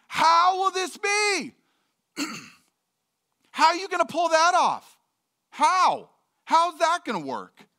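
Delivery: high, loud baffled how; incredulous Mary. Retold or imitated. Mary questioning the angel, high and baffled. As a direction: ask it like you can't believe it. Mary's disbelief voiced.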